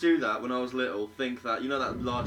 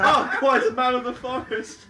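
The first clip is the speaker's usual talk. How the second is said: slow, high, laughing tease; drawn out through laughter; 12 semitones higher, 5 dB louder, slower than usual. Real, not acted. Mocking friend's ocarina story.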